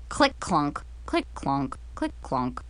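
low, softening step noises; fading sound effect. A narrator's reading. Narrator imitates Mackenzie hobbling down the stairs, fading away.

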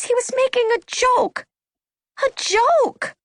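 very high, indignant exclamation; exasperated. A narrator's reading. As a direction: insist with pitch shooting up. Sisters arguing about Alan's remark.

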